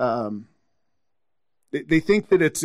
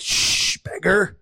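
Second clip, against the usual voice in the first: higher-pitched mock hushing of a pest; shushing; 5 semitones higher than usual. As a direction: imitate officious followers shooing someone away. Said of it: "they kind of tried to silence him".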